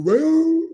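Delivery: imitated wrestler's howl, rising high and loud; Sting. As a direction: let out a high, rising "whoo" holler. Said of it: "like what Sting would do".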